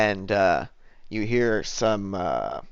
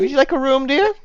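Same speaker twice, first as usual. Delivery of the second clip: high-pitched, louder innkeeper; bartender; 6 dB louder than usual. Acted. Bartender offers the performer a room, high-pitched role voice. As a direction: offer it in a high, put-on landlady voice. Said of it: "and she says".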